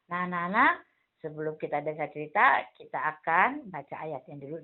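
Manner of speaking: normal talking voice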